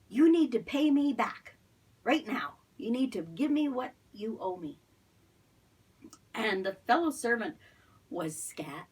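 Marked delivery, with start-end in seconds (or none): 0.1-4.8 higher, louder demand that eases off; angry demand. Acted. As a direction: bark at the debtor to pay up. Angry servant demands money.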